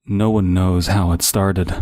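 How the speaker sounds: ordinary delivery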